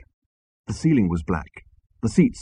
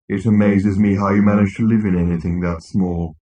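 droning, gloomy robot put-down; scornful robot; 4 dB louder than usual. Acted. Marvin belittling Arthur's mind, gloomy. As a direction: drone with weary contempt.

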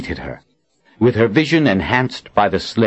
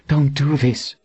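higher, imploring voice; pleading; 7 semitones higher than usual. A narrator's reading. Verna begs her armed friends to stop, pleading. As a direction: beg them to stop. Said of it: "Verna pleaded".

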